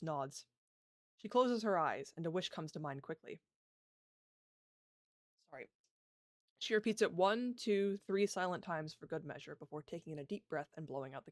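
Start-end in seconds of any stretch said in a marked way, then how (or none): none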